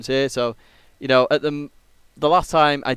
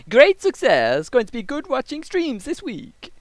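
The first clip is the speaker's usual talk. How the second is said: put-on slow, drawn-out Borat accent, pitched up; 9 semitones higher, slower than usual. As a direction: ham up a Borat impression, stretching each word. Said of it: "And I lost the accent".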